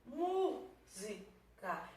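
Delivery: Música slowly, syllable by syllable, MÚ held; Música stretched out with a long first syllable; stress on first syllable. Shown as a demonstration. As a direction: hold the MÚ in Música, then separate the rest. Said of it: "da sílaba tônica".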